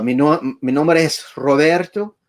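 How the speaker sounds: saying his name in a Puerto Rican Spanish accent. Shown as a demonstration. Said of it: "I have a good, a Puerto Rican accent".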